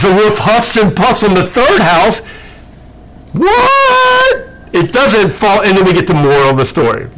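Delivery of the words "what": a squeaky, high astonished what; mock surprise. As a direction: feign total shock. Mock shock at plot twist.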